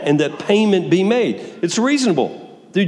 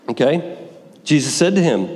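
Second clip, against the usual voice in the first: ordinary talk, nothing marked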